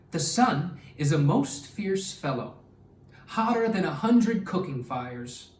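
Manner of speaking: expressive read-aloud storytelling voice; story narration. Acted. Teacher reading a story to her class, lively and expressive. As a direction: read it like a picture book to young kids.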